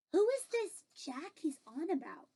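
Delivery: ordinary delivery, nothing marked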